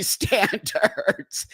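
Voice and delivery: real laugh breaking into the word. Real, not acted. Host telling viewers her thumbnail was flagged, laughing. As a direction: crack up as you say it. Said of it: "And I'm laughing because".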